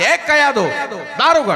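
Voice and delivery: husband calling at the door; loud call. Acted. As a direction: call out to your wife from outside. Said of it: "मोठ्यानं हाक मारली".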